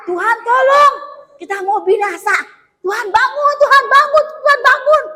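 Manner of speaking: high, rising urgent calls; desperate plea. Acted. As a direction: wake the sleeping master in panic. Disciples beg the Lord.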